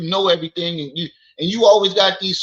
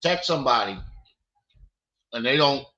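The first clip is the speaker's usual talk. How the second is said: ordinary talk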